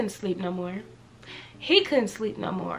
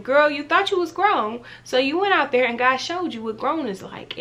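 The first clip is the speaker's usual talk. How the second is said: sassy viewer voice; 5 semitones higher, 6 dB louder than usual. Retold or imitated. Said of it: "you're probably thinking".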